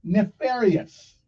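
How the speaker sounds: nefarious said slowly, each syllable drawn out; ne-FA-ri-ous, slow and deliberate; slow, careful word. Shown as a demonstration. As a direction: spell it out with your voice: nefarious. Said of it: "listen closely".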